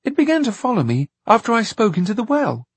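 put-on high, fast boy voice; child voice. A narrator's reading. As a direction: chatter like a small boy. Voicing Jack.

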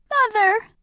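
put-on high, loud grieving child's cry; piercing, distraught call; anguished cry. A narrator's reading. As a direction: cry out for a lost parent. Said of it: "cried Jonas".

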